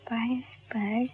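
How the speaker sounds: soft whispered buzz buzz buzz. Acted. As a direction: whisper it like the noise in her head. Patient imitates buzzing in head.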